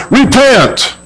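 "Repent." rising high then falling hard; emphatic repent. Acted. Preacher quoting prophets calling the people, emphatic. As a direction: hit "Repent." like a prophet's command.

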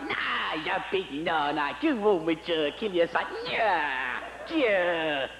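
a lightly voiced son ribbing his dad; mocking son. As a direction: tease the old man, drawn out. Abraham's son mocks his father.

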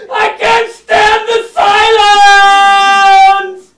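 loud, very slow drawn-out cry; desperate shout. Acted. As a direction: break the silence with a howl. Mime son begs for sound.

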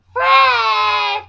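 very high, loud screech; Fred. Retold or imitated. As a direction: shriek it out in an annoying high-pitched voice. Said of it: "The high-pitched fucking annoying".

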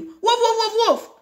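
very high-pitched woofs; dog bark. Acted. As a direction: yap like an agitated dog. Voicing the dog's barks.

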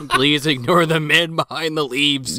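slow line broken by laughter; laughing. Acted. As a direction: giggle through the words.

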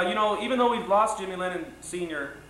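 usual speaking voice

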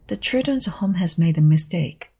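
plain reading voice

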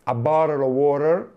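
"a bottle of water" with T turned to R; "a bottle of water" said as "a borel o worer"; American flap. Shown as a demonstration. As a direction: roll through it American style. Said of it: "diventano tutti".

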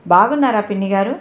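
usual speaking voice

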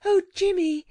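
put-on high, urgent child's appeal. A narrator's reading. Girl begs the gentleman to leave, appealing to him. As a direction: plead with him, pitched well up. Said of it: "appealed Anthea".

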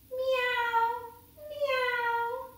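high, drawn-out meows; high-pitched imitation cat call. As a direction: mew like a cat. Voices the cat she meets.

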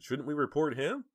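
high-pitched insistent appeal; protesting. A narrator's reading. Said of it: "Dina protested".

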